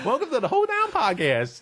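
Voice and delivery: high hillbilly announcer voice; old prospector. Pretend. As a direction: announce it like a frontier old-timer. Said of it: "I'm really an old prospector".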